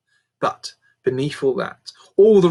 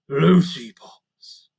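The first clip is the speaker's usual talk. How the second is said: slow, drawn-out half-remembering; repeated slowly; 5 dB louder, much slower than usual. A narrator's reading. King half-remembers his daughter's pet name, slow. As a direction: let the name surface slowly. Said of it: "the king repeated slowly".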